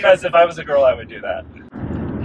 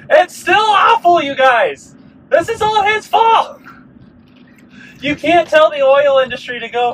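much higher, louder, indignant cry; outraged rant; 10 semitones higher, 6 dB louder than usual. Real, not acted. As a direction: vent real outrage at the price. Ranting about gas prices.

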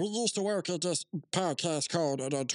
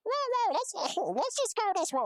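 alarmed whoa. Acted. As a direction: yelp in alarm to stop it. Panics as his friend insults him.

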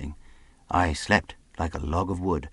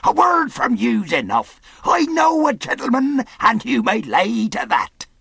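high, loud, hearty holler; cheery shout; 7 dB louder than usual. A narrator's reading. Long John flatters the captain, cheery shout. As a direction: call it across in good cheer. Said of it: "shouted Long John cheerily".

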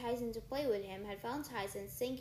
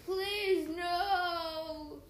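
pleading; 6 semitones higher, 6 dB louder than usual. Acted. Defeated Cyclops begging for life.

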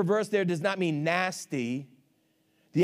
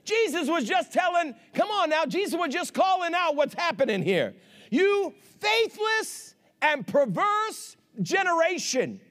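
emphatic preacher voice, higher than normal talk; full-force preaching; 9 semitones higher than usual. Real, not acted. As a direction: punch each phrase out to the congregation.

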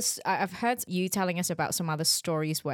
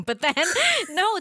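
usual speaking voice

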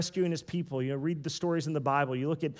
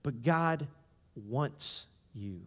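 hushed tender; 5 semitones lower, 7 dB quieter, much slower than usual. Acted. Hushed key phrase.

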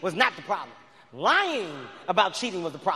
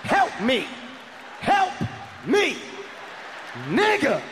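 desperate plea; 3 semitones higher, slower than usual. Acted. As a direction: plead in punchy, urgent bursts. Acts silent desperate plea to friend.

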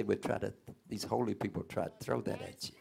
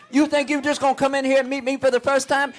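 full-force preaching; 16 dB louder than usual. Acted.

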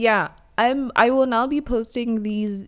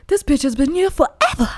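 shocked exclamation; 6 semitones higher, 4 dB louder, faster than usual. Retold or imitated. Retold shocked reaction.

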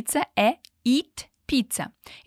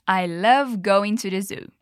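slow model line; 4 semitones lower, slower than usual. Shown as a demonstration. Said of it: "One more time".

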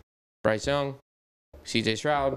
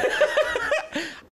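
high-pitched laugh; burst of laughter. Real, not acted. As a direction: laugh at the bad jingle. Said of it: "That was terrible".

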